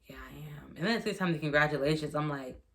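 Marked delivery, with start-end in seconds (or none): 0.1-0.8 a soft, voiceless yes; whisper. Real, not acted. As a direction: whisper the answer, subdued. Quiet reply to the nurse.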